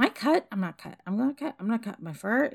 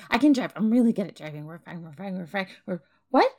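ordinary talk, nothing marked

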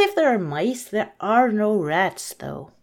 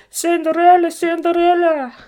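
high, loud sing-out of a name; calling out; 8 semitones higher, 7 dB louder than usual. Acted. Mysterious voice to crying Cinderella by the fire, calling out. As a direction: call her from afar, raised and loud. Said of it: "she heard a voice calling".

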